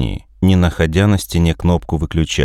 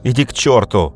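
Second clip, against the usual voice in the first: hysterical shout; 5 semitones higher than usual. Acted. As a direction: scream it in panic. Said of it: "практически в истерике".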